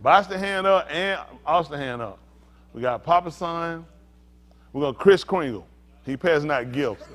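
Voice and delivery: ordinary talk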